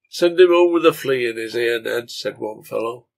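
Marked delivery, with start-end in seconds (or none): 0.1-2.1 louder, egging him on; goading. A narrator's reading. Bar crony to Ned about his father, goading. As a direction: voice a pub crony urging defiance.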